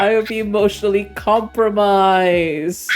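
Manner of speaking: high, laughing mock despair; mock dismay. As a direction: squeal it out, half laughing, pretending to be crushed. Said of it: "I was like".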